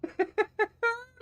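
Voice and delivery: very high squealing laugh. Real, not acted. Laughing at his imagined scene.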